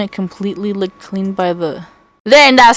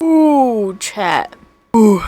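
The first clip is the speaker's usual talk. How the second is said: startled ooh; 3 dB louder, much slower than usual. Real, not acted. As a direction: jump at something unexpected. Startled mid-story by chat.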